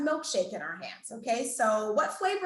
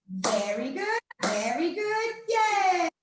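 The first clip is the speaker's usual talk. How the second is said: rising cheer; 5 semitones higher, slower than usual. Shown as a demonstration. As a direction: pitch climbs to a high yay. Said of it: "Let's do it together again".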